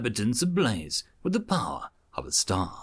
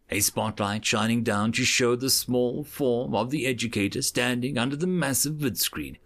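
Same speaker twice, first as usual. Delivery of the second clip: plain reading voice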